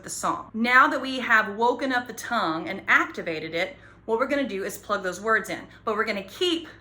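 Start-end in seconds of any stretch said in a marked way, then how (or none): none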